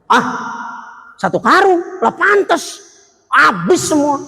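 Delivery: fervent sermon delivery; preaching. Acted. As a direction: lift the voice to preach. Preaching at full force.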